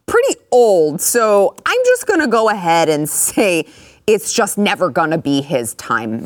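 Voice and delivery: ordinary delivery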